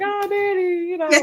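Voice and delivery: put-on high, childlike "Oh, Daddy"; soft little girl voice. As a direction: go way up like a little girl melting for her dad. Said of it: "I just all of a sudden become a lot softer".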